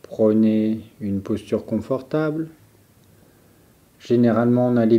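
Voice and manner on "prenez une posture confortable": ordinary delivery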